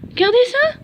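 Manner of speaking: high, loud call to look; excited. Real, not acted. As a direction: call the viewers over eagerly. Shows the cell to viewers. (excité)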